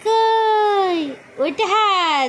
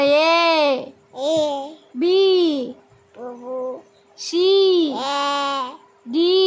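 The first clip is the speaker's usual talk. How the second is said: slow alphabet prompt; 4 semitones lower, much slower than usual. Shown as a demonstration. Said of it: "একটু পড়ো".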